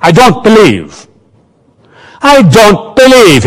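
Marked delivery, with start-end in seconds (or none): 2.3-3.4 higher, louder mocking echo of her words; sing-song mocking imitation; mocking mimicry. A narrator's reading. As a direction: parrot her line back to tease. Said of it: "he mimicked".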